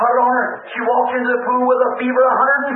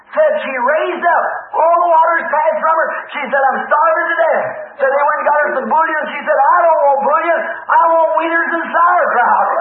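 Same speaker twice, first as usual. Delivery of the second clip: high, fervent report of a recovery; excited retelling; 5 semitones higher than usual. Retold or imitated. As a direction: tell it like astonishing good news. Acted report of sudden recovery.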